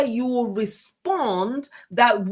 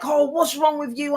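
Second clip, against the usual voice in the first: a mild imitated huffy outburst, pitched higher; mock tantrum; 5 semitones higher than usual. Wife retelling how she did not react, mild mock tantrum. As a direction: lightly play the sulky wife snapping back. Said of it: "going off in a tantrum".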